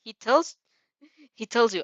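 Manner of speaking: ordinary delivery, nothing marked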